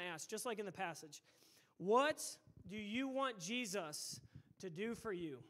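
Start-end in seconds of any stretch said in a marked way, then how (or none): 1.8-5.4 "What" punched out, then a pause before the rest; deliberate question. Real, not acted. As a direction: pose it in weighty pieces, pausing after "What". Key question posed, then repeated quicker.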